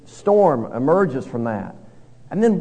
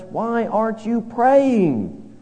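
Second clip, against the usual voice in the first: higher, slow pleading question; pleading captain; 7 semitones higher, much slower than usual. Retold or imitated. Heathen captain pleading with prophet, higher and slow. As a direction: draw it out, begging him to pray. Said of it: "He says".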